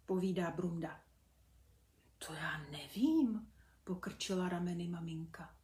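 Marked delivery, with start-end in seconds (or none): 2.2-3.5 whispered shrug. Acted. Mother unsure, shrugging.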